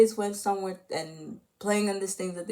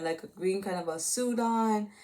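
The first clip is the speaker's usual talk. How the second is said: normal talking voice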